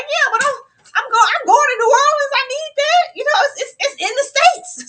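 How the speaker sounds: high, loud squeaky mock voice; mock Mister Johnson. Mock Mister Johnson insisting New Orleans needs a passport. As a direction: play him as clueless and proud. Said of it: "It's like".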